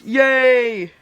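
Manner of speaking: loud, high, held yay; long happy cheer. Acted. Acting character's cheer in story.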